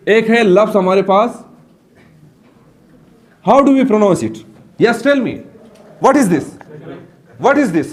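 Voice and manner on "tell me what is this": ordinary talk, nothing marked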